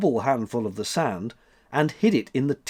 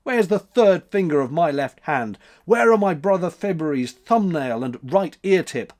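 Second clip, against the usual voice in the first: louder, higher blustering shout; 5 semitones higher, 5 dB louder than usual. A narrator's reading. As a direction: rage at the boy. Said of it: "shouted March".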